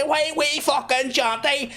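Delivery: high, drawn-out Belfast mock voice; broad Belfast accent. Shown as a demonstration. Streamer showing the accent everyone loves, high slow Belfast. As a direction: stretch it in hammed-up high Belfast. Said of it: "but the accent, right?".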